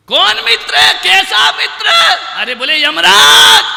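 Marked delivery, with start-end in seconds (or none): 0.1-1.9 loud, high baffled questions; surprised shout. Acted. Seth questioning the stranger at his door, loud surprise. 2.8-3.6 high, loud proclaimed name; loud announcement. Acted. Yamraj announcing himself at the seth's door, loud.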